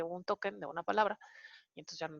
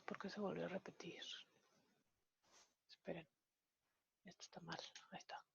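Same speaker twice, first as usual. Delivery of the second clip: unvoiced mutter under the breath to herself; whispered aside; 18 dB quieter, faster than usual. Real, not acted. Teacher mutters to herself at a code error, whispering. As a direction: hush it, as if nobody should hear.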